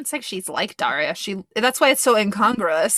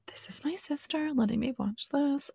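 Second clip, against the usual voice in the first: high, whispery, much softer; hushed retold thought; 7 semitones higher, 9 dB quieter than usual. Younger sibling marvelling at sister's trust, hushed. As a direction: imitate a kid's hushed awe.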